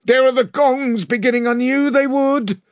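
high, tense voice of dread; he knew this was coming; dread-filled sailor. A narrator's reading. A sailor tells the crew he hears gongs, high and tense.

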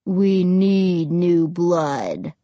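low, very slow ghostly drone; ominous dead boy. A narrator's reading. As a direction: draw each word out menacingly. Dead boy demands blood.

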